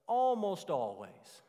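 preacher stressing almost. Shown as a demonstration. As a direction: press the word almost. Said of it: "I emphasized almost".

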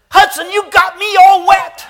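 put-on very high, loud whiny kid; upset child shout. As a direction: yell it soaked and upset. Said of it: "He said".

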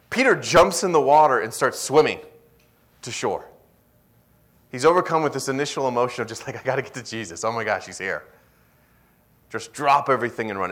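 loud, high, fired-up sermon delivery; full-force preaching. Acted. Preacher urging the congregation, loud and fired up. Preaching about Peter's excitement.

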